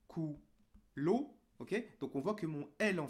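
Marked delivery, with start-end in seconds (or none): 0.1-1.4 "coulo" with an O after the L; louder "coulo", ending on an added O; wrong added vowel. Shown as a demonstration. Teacher showing what was not said, added final O. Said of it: "J'ai pas dit".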